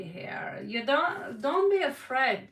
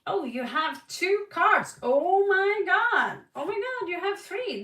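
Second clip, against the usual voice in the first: high, loud amazed exclamation; delighted shock at the cards; surprised; 8 semitones higher, 7 dB louder than usual. Real, not acted. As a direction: gasp at the cards in real surprise.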